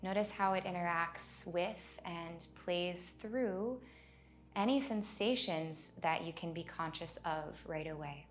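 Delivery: unhurried meditation leading, leaving space for breath; guided meditation. Acted. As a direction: guide them gently inward, phrase by phrase.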